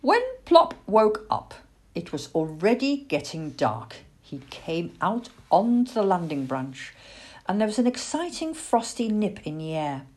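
ordinary delivery, nothing marked

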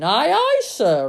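high, loud sailor's reply; eager, ringing acknowledgement; smart reply. A narrator's reading. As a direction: answer the captain smartly, at full voice. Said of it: "just as smartly".

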